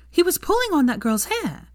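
sharp and high, clearly irritated; snapping. A narrator's reading. Hermione snapping at Draco over the student. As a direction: snap back at him. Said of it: "snapped Hermione".

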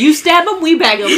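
bouncy shouted tagline; loud gleeful punchline. Pretend. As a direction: deliver the joke with relish. Punchline quoted with glee.

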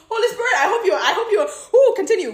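high, loud burst of excitement; excited exclamation. Real, not acted. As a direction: cry out, thrilled by the inspiration. Excited aside to Holy Spirit.

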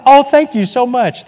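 a high, sing-song imitation of a pleased stranger; mock delighted thanks. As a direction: gush your thanks in a high pitch, like someone pleased by a free card. Imitating grateful passer-by.

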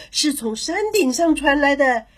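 usual speaking voice

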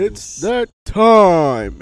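announcer. Acted. Announcing the segment.

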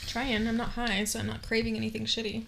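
chewing while talking; words round a bite of food; mouth full. Real, not acted. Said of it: "Enjoy [name] chewing on an apple".

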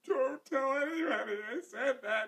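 high-pitched mock crying child; imitated tearful plea. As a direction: plead like a child in tears. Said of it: "she's in tears".

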